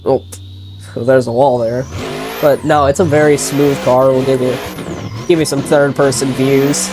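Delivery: usual speaking voice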